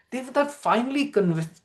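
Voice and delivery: plain reading voice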